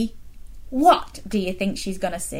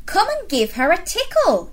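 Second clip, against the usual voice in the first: encouraging Miranda; 9 semitones higher than usual. Acted. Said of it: "encouraged Miranda".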